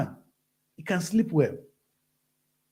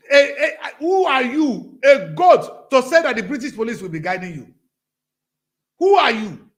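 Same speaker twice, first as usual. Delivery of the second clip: indignant outburst; 6 semitones higher, 8 dB louder than usual. Real, not acted. Scolding the pampered man with police escort, angry and loud. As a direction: tear into him with disgust. Mocking the big-bellied man.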